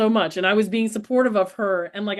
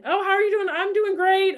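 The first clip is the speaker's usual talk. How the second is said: much higher, chirpy bank-counter chatter; cheery small talk; 10 semitones higher than usual. Retold or imitated. Chatting with the bank teller while crying, much higher and chirpy. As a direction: chirp it brightly as if all is fine. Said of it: "I'm at the teller".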